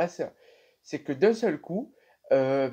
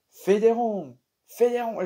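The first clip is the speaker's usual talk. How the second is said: mock-aggressive command, louder and higher, slow; aggressive order; 7 semitones higher, 5 dB louder, slower than usual. Old man ordering her to make circles, mock-aggressive. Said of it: "le monsieur devient de plus en plus agressif".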